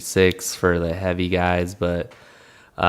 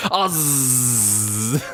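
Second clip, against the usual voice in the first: laughing; 3 semitones higher, 3 dB quieter than usual. Real, not acted. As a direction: crack up mid word. Cracking up at own joke.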